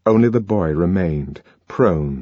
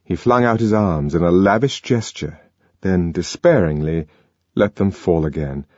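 ordinary talk, nothing marked

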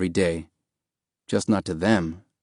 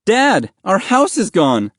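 high, loud and slow dismay; boy's raised, upset voice; distraught; 10 semitones higher, 5 dB louder, slower than usual. Acted. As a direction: cry out the bad news to dad. Distraught son tells his father.

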